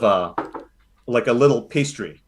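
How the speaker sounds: usual speaking voice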